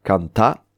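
"Canta" rising onto a stressed "ta"; stress on last syllable. Shown as a demonstration. Teacher repeating the Occitan verb, stress on "ta" in "Canta". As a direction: lift and land on "ta" in "Canta". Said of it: "l'accent est sur le ta".

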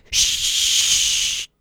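a sharp whispered shush; hushing whisper. Acted. Family shushing the curious child, whispered. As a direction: hush him at once. Everyone hushes the little elephant.

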